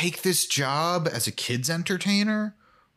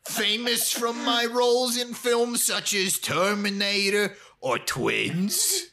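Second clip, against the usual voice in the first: much higher, slow, drawn-out silly voice; hammed-up bad action-star impression; mock Arnold Schwarzenegger; 7 semitones higher, slower than usual. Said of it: "Arnold Schwarzenegger".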